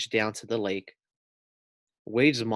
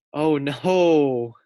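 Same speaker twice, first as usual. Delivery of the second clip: gasping dismay; 5 semitones higher, 5 dB louder than usual. Real, not acted. Teacher seeing the pig drift away, gasping. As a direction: gasp then louder oh no.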